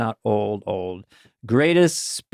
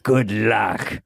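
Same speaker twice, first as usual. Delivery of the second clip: slow, low mock villain; good luck voice; 3 semitones lower, slower than usual. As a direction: play an unbothered movie villain, drawn out. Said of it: "That's the good luck voice".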